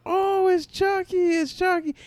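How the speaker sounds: put-on high, slow kid voice; excited kids. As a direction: squeal it like thrilled children. Kids spotting Chucky costume.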